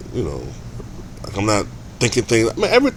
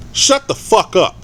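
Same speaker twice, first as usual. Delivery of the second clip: angry dismissal; 9 semitones higher than usual. Real, not acted. Ranting at Ayesha Curry.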